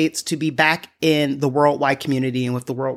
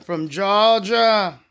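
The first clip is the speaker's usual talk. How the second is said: Georgia stretched very long, higher; drawn-out GEORGIA; 4 semitones higher, much slower than usual. Acted. Host introducing the genius from Georgia, Georgia drawn right out. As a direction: drawl out Georgia slowly and playfully. Playful repeat of the state name.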